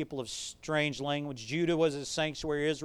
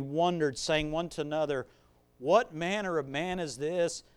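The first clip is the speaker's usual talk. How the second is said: scripture reading; 4 semitones lower than usual. Acted. Preacher reading Luke to his church, calm scripture voice. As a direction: read the passage low and steady.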